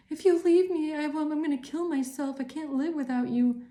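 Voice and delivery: high mock desperate pleading; mocking clingy lover. Mocking the clingy partner who threatens to kill herself, high whiny plea. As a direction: whine it like a clingy ex begging. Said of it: "they're trying to say you're that kind of a person".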